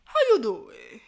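lightly voiced Wendy Williams greeting; playful nod to Wendy Williams, lightly done; Wendy Williams impression. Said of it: "put on my Wendy Williams voice".

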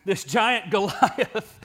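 slow, laughing "Goliath"; amused. Real, not acted. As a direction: chuckle at your own slip while restarting. Laughing at own slip on name.

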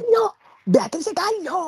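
calling out. Retold or imitated. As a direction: yell her full name across. Calling a sleeping friend.

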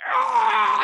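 high, loud mock scream; half-demonic mock screech; imitated demonic scream. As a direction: let out a sudden high shriek in imitation. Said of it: "screaming with a half demonic, half human voice".

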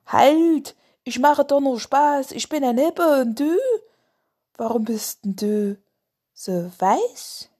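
loud, high cheerful hippo; jolly hippo voice. Acted. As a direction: call out brightly, a friendly big animal. Hippo calls after Lars.

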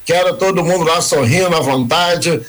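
ordinary talk

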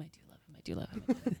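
very soft, jokey repetition, almost under the breath; muttered self-reassurance. Pretend. Mother joking about loving her son, muttered self-reassurance. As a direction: mutter it as if convincing yourself.

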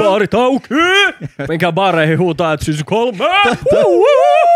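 loud, high hyped yelling; rallying party shout. Real, not acted. Telling people to go shout season three in bars, hyped yelling. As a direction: holler it to a packed bar, ending in hoots.

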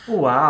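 high loud wow; loud mock wow. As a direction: blurt out a big wow. Sudden loud exclamation. (uau alto e agudo)

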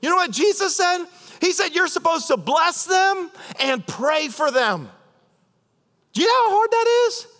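high shouted exhortation; preaching shout. Acted. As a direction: belt it out from the pulpit.

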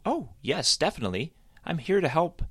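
eager bunny reassuring Sally; confident Sam. A narrator's reading. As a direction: promise it with bouncy confidence. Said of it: "he said confidently".

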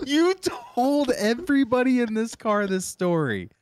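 loud, high, cracking up; incredulous laughter. Real, not acted. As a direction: cry it out in amused disbelief. Cracking up at the reveal.

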